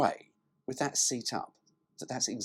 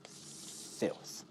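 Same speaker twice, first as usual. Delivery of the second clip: sly whispered aside; 12 dB quieter than usual. Acted. Smutty whisper to viewers about the heated window. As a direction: breathe it out like a dirty word. Cheeky aside after brochure line.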